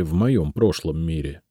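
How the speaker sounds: usual speaking voice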